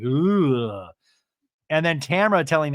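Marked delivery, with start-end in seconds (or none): 0.0-0.9 long drawn-out "ugh"; mock groan of reaction; imitated disgusted groan. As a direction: groan it out, rising then falling. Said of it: "Heather's reaction to that is like".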